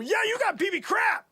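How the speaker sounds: high, loud jeer; scornful retort. Acted. Boss jeers at Timmy's pitch, scornful shout. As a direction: throw it back with scorn.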